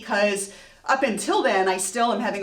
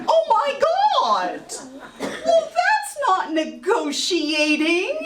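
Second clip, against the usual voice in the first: very high, drawn-out mock shriek; loud, slow, high-pitched protest; outraged panic; 12 semitones higher, 3 dB louder, much slower than usual. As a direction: squeal in hammed-up disbelief. Outraged panic at security call.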